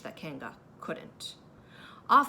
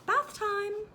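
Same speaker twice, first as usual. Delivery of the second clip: much higher, sing-song mother voice; mock motherly Kanga; 13 semitones higher, 4 dB louder than usual. A narrator's reading. Kanga pretending Piglet is Roo, high and sing-song. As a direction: call up bright as a mother.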